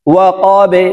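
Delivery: "ওয়া কবে" with the ব bounced downward; downward qalqala. Shown as a demonstration. Teacher modelling "ওয়া কবে" for learners, downward qalqala. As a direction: push the end of "ওয়া কবে" into a short e. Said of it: "নিচের দিকে ধাবিত করে কলকলা".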